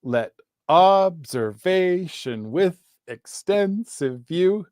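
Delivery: "Let observation with extensive view" stretched out to show five beats; iambic stress. Shown as a demonstration. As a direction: exaggerate the weak-strong beat in "Let observation with extensive view". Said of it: "iambic pentameter".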